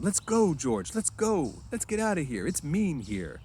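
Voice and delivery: high, eager urging; eager pleading. A narrator's reading. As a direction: beg to leave now. Lenny eager.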